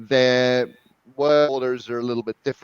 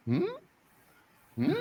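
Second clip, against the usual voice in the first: short questioning hum, imitating Japanese surprise; surprised Japanese "hmm?"; 6 dB quieter than usual. Coach imitating surprised Japanese speakers, questioning hum. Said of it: "when they're surprised by something".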